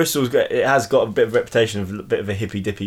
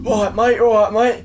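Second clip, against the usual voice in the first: high-pitched shouted greeting from a stranger; retold shouting; 11 semitones higher than usual. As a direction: imitate a man hollering over at someone. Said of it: "someone starts shouting at me like".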